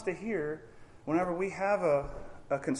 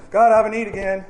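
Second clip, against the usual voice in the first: bursting in; 6 semitones higher, 10 dB louder, faster than usual. Retold or imitated. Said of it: "When we come running in".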